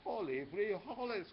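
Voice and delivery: a hammed-up yelp of alarm; cried out. A narrator's reading. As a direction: shout it as the bandito looms. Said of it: "cried Skipito".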